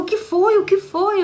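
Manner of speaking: high, concerned questioning; husband alarmed at her crying, high and worried. Retold or imitated. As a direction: ask like a startled husband.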